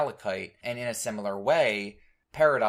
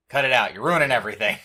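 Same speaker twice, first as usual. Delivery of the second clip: raised voice telling them off; loud scolding; 2 semitones higher, 8 dB louder, much faster than usual. Retold or imitated. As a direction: snap at them to stop. Scolding quote, louder.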